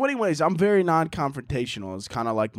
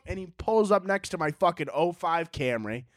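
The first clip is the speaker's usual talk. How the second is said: ordinary delivery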